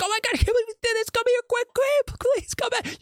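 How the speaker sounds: high-pitched pretend hysteria on a 911 call; mock frantic screaming. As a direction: act out a panicked husband begging for help. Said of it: "You'd be yelling and just screaming like".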